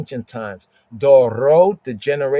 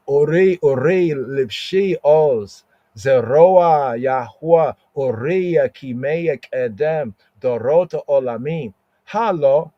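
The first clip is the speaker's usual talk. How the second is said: scripture recitation; 3 semitones higher than usual. Acted. Teacher reciting the Isaiah verse in Hebrew to the class, proclaiming. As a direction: proclaim each Hebrew word like a psalm.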